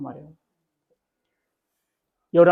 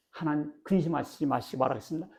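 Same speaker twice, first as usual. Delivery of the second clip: usual speaking voice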